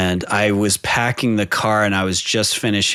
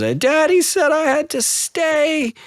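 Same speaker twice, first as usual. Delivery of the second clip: put-on much higher, slow, whimpering child voice; crying little girl. Four-year-old tells mom what daddy said, mock sobbing. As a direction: imitate a little girl wailing through tears. Voicing daughter in tears.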